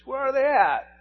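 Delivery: high, loud exasperated demand; impatient child's yell. Retold or imitated. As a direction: imitate a frustrated boy calling out. Impatient son searching water for fish.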